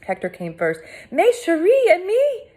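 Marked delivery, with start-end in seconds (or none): 1.1-2.5 high loud relieved French cry; cried out. A narrator's reading. Hector crying out on finding the girl. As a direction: greet her with overjoyed alarm. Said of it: "he cried".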